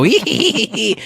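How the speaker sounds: high mock leprechaun giggle; hee-hee it like a cheeky leprechaun. Host mimics his co-host's leprechaun giggle, high and mocking. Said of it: "and then you go".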